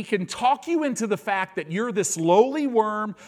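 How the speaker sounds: high, loud, rising sermon climax; fiery preaching. Acted. Preacher rousing his congregation, full force preaching.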